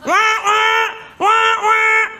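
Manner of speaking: high, loud mocking wah wah; mimicking impatient drivers; mock wailing. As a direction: whine it like honking complainers. Mocking honking drivers behind.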